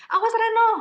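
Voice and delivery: loud, high-pitched warning cry; high intonation. Shown as a demonstration. Reading teacher showing high intonation to adults, high and loud. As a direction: call it out high and urgent, far above reading pitch. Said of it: "tinggi atau rendahnya nada, itu bisa kita mainin".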